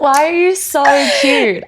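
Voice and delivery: high, loud laughing gush. Real, not acted. Gushing back at her friend's praise, laughing. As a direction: giggle it out, touched and delighted.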